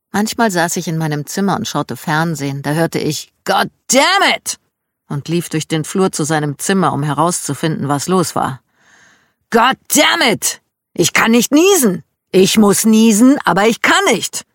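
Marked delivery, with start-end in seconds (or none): 3.4-4.6 high, drawn-out holler; long, high exasperated cry; shouted curse. A narrator's reading. Narrator voices Dad yelling from his room. 9.5-14.4 loud, high-pitched frustrated rant; high, loud comic complaint; exasperated shouting. A narrator's reading. Narrator voices Dad unable to sneeze.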